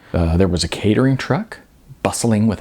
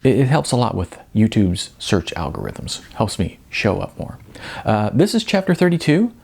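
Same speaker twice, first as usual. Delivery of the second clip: plain reading voice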